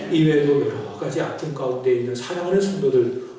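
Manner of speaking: earnest pulpit prayer, loud at the peaks. Acted. Pastor praying for sick church members, earnest prayer. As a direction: pray over the sick members.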